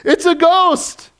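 high, loud mock-panicked yell; hammed-up frightened shout; crying out in fear. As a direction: yell it in played-up terror, stretched out. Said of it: "they cried out for fear".

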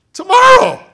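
squeaky, very high and loud tomorrow with a rise; a silly falsetto tomorrow; mock Pharaoh. Preacher mocking Pharaoh's answer, high and loud.

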